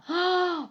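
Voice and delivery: acted sharp gasp; shocked gasp. Jesus looking inside the temple, indignant gasp. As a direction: gasp as if appalled.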